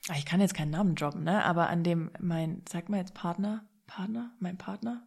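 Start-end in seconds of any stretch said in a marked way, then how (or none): 2.7-5.0 whispered, trying the word out; testing a word. Real, not acted. Trying out what to call her partner, whispered. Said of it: "Wie fühlt sich das an?".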